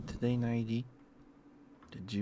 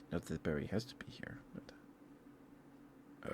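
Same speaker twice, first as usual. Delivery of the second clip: soft, hushed, a bit faster; whispered aside; 4 semitones lower, 8 dB quieter, slower than usual. Real, not acted. Streamer confiding to chat about Barry, whispered. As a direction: lean in and whisper the gossip.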